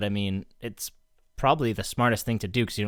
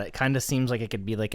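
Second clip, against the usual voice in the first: ordinary talk, nothing marked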